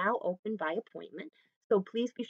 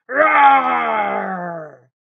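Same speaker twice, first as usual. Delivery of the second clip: long, very loud lion roar falling away; big drawn-out playful roar; 14 dB louder than usual. Pretend. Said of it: "give a roar".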